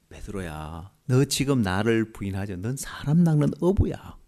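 soft, tender, quiet; tender Jesus. Retold or imitated. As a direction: gently tell him who he truly is. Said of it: "돌이켜서 베드로를 보실 때 예수님은". (부드럽고 다정하게 속삭이듯)

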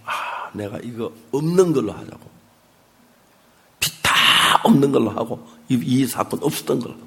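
usual speaking voice

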